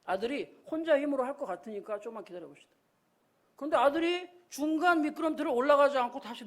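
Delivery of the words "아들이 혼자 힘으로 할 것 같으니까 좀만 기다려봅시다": soft, low husband's reply; calm father. Retold or imitated. Bruce answers his wife about their son, calm and soft. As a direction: quietly ask her to hold off. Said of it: "브루스가 그랬습니다".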